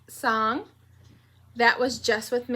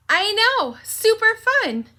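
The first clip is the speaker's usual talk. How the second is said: excited; 10 semitones higher, 4 dB louder than usual. Acted. Teacher hyping the class.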